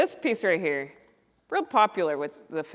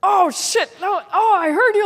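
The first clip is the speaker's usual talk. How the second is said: startled man's reply, high and loud; Bill Burr's reply; 12 semitones higher, 10 dB louder than usual. Retold or imitated. Bill Burr startled by her greeting, high and loud. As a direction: react like you've been startled. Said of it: "And then he goes".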